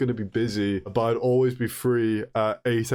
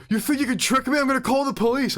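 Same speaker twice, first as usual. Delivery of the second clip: mock angry caller; 10 semitones higher than usual. Angry caller voiced in phone story.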